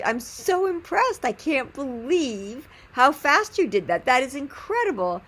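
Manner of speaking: high-pitched, drawn-out gushing praise; bowled over, stretching the key words; gushing amazement. Retold or imitated. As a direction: gush with slow, amazed emphasis.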